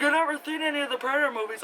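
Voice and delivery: high, quick put-on voice; imitated coworker. Imitating a coworker who hasn't seen the films, high-pitched. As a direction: mimic someone in a squeaky, hurried voice.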